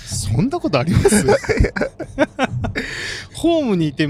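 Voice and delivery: high amused question into a laugh; laughing disbelief. Real, not acted. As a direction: ask it incredulous, then burst laughing. Disbelief at a missed train.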